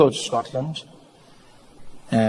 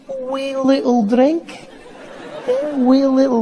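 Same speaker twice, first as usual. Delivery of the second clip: tiny-sounding Scottish; 10 semitones higher, 3 dB louder than usual. Retold or imitated. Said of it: "Sounds tiny".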